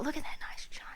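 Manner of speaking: quick unvoiced whisper. Real, not acted. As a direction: murmur it in awe. Hushed wonder at china.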